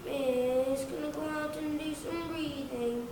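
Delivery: put-on whiny kid. Whiny kid asking miss to go out, whining plea. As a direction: plead whinily, stretching the words. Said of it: "one whiny kid going".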